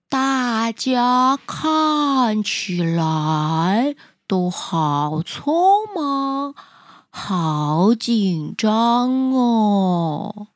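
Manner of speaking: very slow, drowsy sloth drawl; sleepy sloth voice. Acted. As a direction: stretch every word out like a lazy sloth. Sloth muses to himself.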